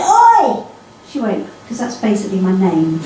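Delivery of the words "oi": a loud call of Oi from mum; calling across the shop with a sharp Oi; mock shout. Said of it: "she shouted at me and she went".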